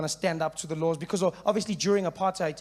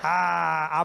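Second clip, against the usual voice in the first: ordinary talk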